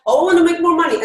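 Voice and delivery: high, loud, eager client voice. Retold or imitated. Coach quoting clients who come to her, loud high eager plea. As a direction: burst out like a client desperate for it. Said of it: "they're coming like".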